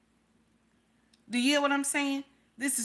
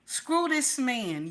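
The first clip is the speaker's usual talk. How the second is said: slow emphatic; 3 semitones higher, much slower than usual. Real, not acted. Advising wives, slow and emphatic. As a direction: drag each word out slowly. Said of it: "slow emphatic repeat".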